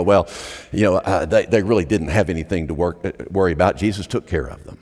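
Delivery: low, lazy self-satisfied mock voice; sanctimonious. Preacher mimicking smug congregants, low and sanctimonious. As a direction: send up the pious know-it-all. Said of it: "in our sanctimonious, we go".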